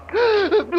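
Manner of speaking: a sharp startled gasp; frightened gasp. Acted. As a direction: catch your breath in shock. Sees the drugged people.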